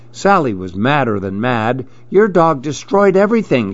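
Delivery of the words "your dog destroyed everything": high, quick storybook outburst; mild narrated shout, raised in pitch; angry shout. A narrator's reading. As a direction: read an accusation fast and high. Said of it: "she shouted and signed".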